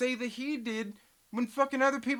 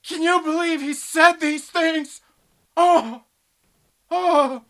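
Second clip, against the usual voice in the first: mocking yell; 8 semitones higher, 10 dB louder, slower than usual. Real, not acted. Mocking streamer drama.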